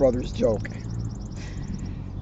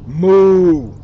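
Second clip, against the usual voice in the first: long, loud mooing; cow moo; 9 dB louder than usual. Acted. Reading to kids, voicing the cows: long loud moo. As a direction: bellow it like a cow.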